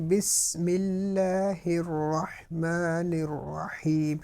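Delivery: drawn-out Quranic recitation, each syllable stretched; slow recited demo. Shown as a demonstration. Teacher reciting slowly for students to copy. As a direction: chant it slowly with tajweed. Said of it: "اب دیکھیں انہوں نے".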